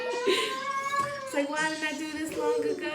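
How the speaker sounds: high, soft, dreamy voice; floating bliss. Retold or imitated. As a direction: float it out as if the medicine kicked in. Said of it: "like I just started floating".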